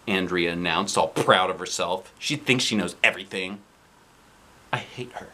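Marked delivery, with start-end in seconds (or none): 4.7-5.3 whispered grumble; hushed aside. Acted. Boy grumbles about the know-it-all, whispered. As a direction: mutter it under your breath.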